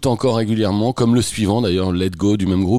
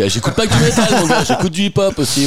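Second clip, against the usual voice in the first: much higher, mock-boastful teenage swagger; mocking teen self; 10 semitones higher than usual. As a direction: send up your smug younger self bragging. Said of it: "j'avais l'impression d'être hyper ouvert d'esprit".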